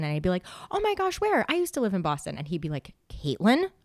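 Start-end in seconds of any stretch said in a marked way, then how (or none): 0.7-2.4 high-pitched gushing surprise; retold over-eager chatty reply; excited chatter. Retells her own over-chatty reply. 3.1-3.7 imitated boss's reprimand. Boss cuts off her chatter.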